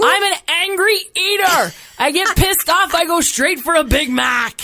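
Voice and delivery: high, loud mock rage; hammed-up anger. Real, not acted. Confessing angry eating, loud mock rage. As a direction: bark it like a cranky eater.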